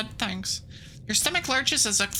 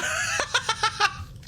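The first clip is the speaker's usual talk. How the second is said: sudden high squeal of laughter; high squeaky laugh; 14 semitones higher than usual. Real, not acted. Cracking up at Gremlin Prime, high squeaky laugh.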